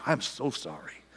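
soft whispered sympathy; Jesus comforting the woman in a whisper; whispered sorry. Retold or imitated. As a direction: breathe it to her gently. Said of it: "I think what he's saying is this".

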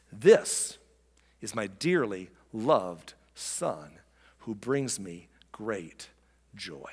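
low, slow, hushed and reverent; God's tender pride, measured with long pauses; voice of heaven. Acted. Preacher voices God to the crowd at the baptism, tenderly solemn.

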